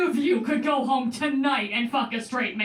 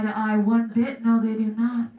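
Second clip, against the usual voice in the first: whispering; 3 semitones lower than usual. Pretend. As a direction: breathe it like a secret plot twist. Hushed trailer plot line.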